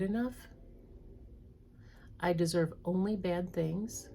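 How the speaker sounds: slow, deliberate list reading with long pauses; read slowly. Shown as a demonstration. Therapist reading beliefs to viewers, slow and deliberate. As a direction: let each belief land, slowly. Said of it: "I'm gonna read them slowly".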